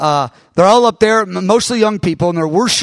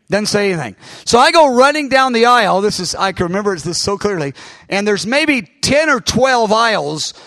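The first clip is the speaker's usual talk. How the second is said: normal talking voice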